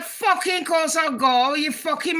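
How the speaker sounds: high, eager retort. Retold or imitated. Eager, telling her brother she'd go. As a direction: fire it back like it's obvious.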